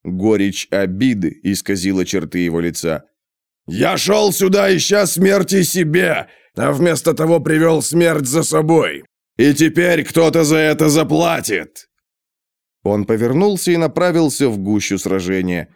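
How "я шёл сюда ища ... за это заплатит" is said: booming thunder and wind. A narrator's reading. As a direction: roar in bitter fury. Gotrek's bitter vow continues.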